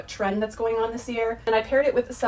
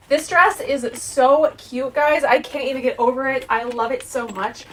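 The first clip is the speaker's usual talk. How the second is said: excited gushing; 3 semitones higher, 7 dB louder than usual. Real, not acted. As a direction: gush over the dress like you can't contain it. Gushing over her dress.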